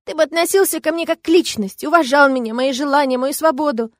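high, emphatic list of grievances; tearful reproach. A narrator's reading. Woman demands respect from the dragon, tearful reproach. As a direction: pile up the complaints, upset. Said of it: "всхлипывая и заикаясь".

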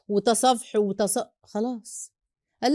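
ordinary talk

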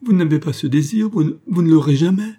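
high, loud distressed plea; plaintive protest. A narrator's reading. Daughter refusing father's marriage, plaintive. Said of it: "Le son de cette voix plaintive".

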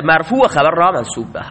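ordinary talk

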